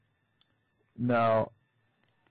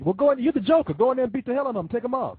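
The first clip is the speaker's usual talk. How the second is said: high-pitched, playful goading; mock egging-on; 10 semitones higher, 3 dB louder, faster than usual. Host jokingly sends the Joker caller in to attack, excited goading. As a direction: urge him into the fight, pitched way up.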